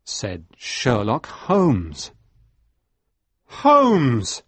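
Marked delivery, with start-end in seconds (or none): 3.5-4.4 higher, louder shout of the name; surprised cry. A narrator's reading. Watson shocked to find Holmes at the hut, loud cry. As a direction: cry out in shock at seeing him. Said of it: "I cried".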